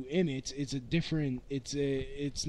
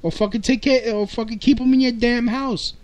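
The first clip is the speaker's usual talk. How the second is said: yelling rant, much louder; 6 semitones higher, 12 dB louder than usual. Real, not acted. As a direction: shout it out, fed up. Ranting about bad parents.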